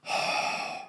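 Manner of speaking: long exasperated exhale; weary, breath-only sigh; heavy sigh. Acted. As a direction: sigh out your frustration. Hitler takes in bad news.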